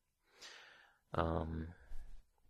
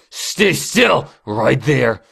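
loud, high, forceful command; shouted order; 5 semitones higher, 22 dB louder, much slower than usual. Acted. Boss ordering employee to hold still, commanding shout. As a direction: order them to freeze.

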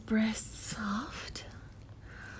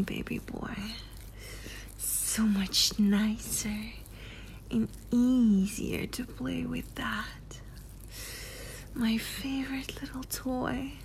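ASMR whisper; 6 dB quieter than usual. Acted. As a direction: lean in close and whisper it. Erotic roleplay, whispered intimacy.

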